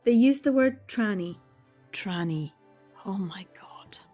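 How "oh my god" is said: ordinary delivery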